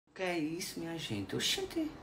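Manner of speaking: what on earth is this; surprised. Real, not acted. Puzzled by something strange.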